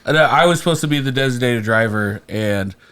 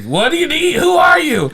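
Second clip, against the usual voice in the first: shouted sharply, pitched way up; startled yell; 4 dB louder than usual. Retold or imitated. Waking to a drunk woman opening his car door, startled high yell. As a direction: jolt awake and yell at the stranger.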